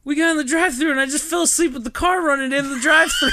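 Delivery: high, loud, frantic explanation; panicked yelling. Retold or imitated. Driver confessing to friends he fell asleep, panicked yelling.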